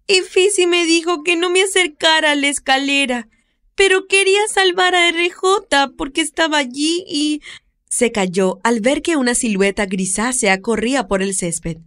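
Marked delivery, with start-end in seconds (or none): 0.1-7.4 put-on high, eager child calling out; little girl voice. Little girl calls the older woman about the cat, high child voice. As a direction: play a worried little girl.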